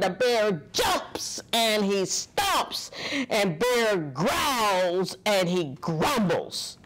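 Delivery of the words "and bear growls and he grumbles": ordinary delivery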